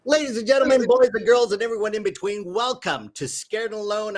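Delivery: loud, high showman intro; announcer. Acted. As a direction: welcome the crowd like a showman. Host opens the live show.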